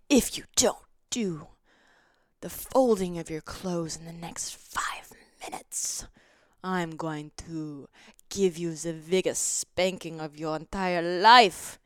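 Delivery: low, slow, half-whispered playful threat; mock dominatrix. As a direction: menace him teasingly in a whisper, then slow down. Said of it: "some sort of dominatrix".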